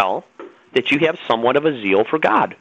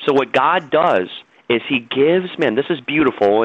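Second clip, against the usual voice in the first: ordinary delivery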